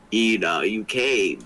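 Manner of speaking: put-on deep voice for a girl character; low girl voice. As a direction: speak her lines low and steady. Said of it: "Why are you talking like that?".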